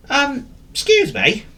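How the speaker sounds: high, slow dog character voice; high-pitched, hesitant cartoon dog; forgetful dog. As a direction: politely get attention in a high dog voice. Dog politely asks the cow.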